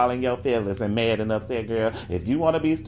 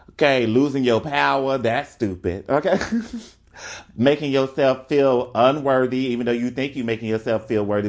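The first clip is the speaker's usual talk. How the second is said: ordinary delivery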